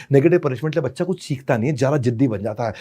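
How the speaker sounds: ordinary delivery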